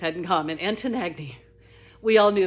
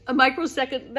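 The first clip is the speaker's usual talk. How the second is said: ordinary talk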